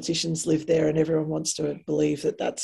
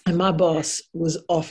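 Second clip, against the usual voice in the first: ordinary talk, nothing marked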